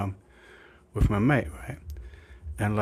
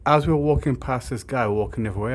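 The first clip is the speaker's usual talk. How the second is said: ordinary talk, nothing marked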